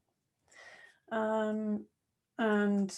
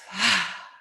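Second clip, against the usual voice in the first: a short "ha" breathed out; exhale sigh; 4 dB louder than usual. Shown as a demonstration. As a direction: push the air out through the mouth. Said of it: "then out through the mouth".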